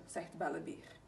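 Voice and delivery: ordinary delivery, nothing marked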